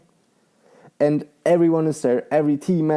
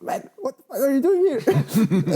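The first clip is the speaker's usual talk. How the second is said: high-pitched, incredulous mock outburst; baffled workmate; 10 semitones higher than usual. Workmate finds him asleep on site, imitated high and baffled. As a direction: imitate a stunned workmate, amused.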